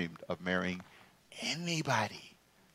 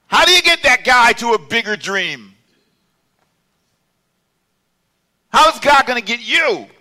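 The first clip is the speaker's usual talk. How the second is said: loud, high, impassioned testimony; high-pitched preacher's shout; full-force preaching; 12 semitones higher, 11 dB louder than usual. Real, not acted. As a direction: preach it out to the whole congregation.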